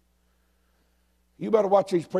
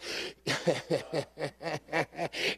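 chuckling laugh; 10 dB quieter than usual. Real, not acted. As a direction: chuckle to yourself in a steady run. Laughs at own remark.

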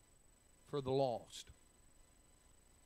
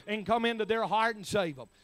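loud, driving preacher cadence, pitched higher; preaching at full force; 5 semitones higher, 8 dB louder than usual. Acted. Pastor urging his church about prayer, full-force preaching. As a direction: pound it home like a revival preacher.

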